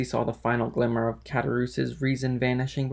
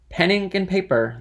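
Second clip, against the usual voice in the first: higher, louder calling out; 6 semitones higher, 5 dB louder than usual. A narrator's reading. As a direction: call out for the waiter. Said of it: "then called Fernand loudly".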